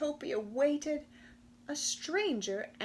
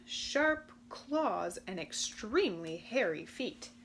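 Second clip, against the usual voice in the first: ordinary delivery, nothing marked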